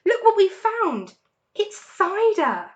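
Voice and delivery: high, loud, slowing on cider; excited cry. A narrator's reading. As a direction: announce the find with glee. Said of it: "he cried out".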